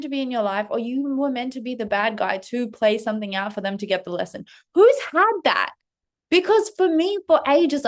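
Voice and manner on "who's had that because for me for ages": excited. Real, not acted. Excited outburst about weird experience.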